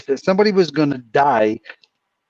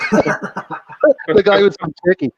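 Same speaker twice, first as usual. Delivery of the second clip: high, slow, helpless laughing delivery; laughing punchline; 7 semitones higher, 3 dB louder, much slower than usual. Real, not acted. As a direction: crack up while finishing the joke. Cracking up at own punchline.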